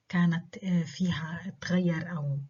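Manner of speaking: normal talking voice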